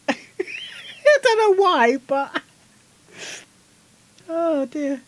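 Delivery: high, slow words through laughter; giggly high-pitched aside; giggling. Real, not acted. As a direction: laugh helplessly while talking. Laughing at own joke.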